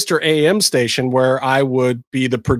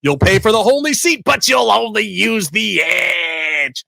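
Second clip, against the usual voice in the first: slow, booming arena-ad announcer; mock arena-event radio spot; monster truck promo voice; 2 semitones higher, slower than usual. Shown as a demonstration. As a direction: hype it like a Sunday Sunday Sunday ad. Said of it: "I was the voice of Sunday".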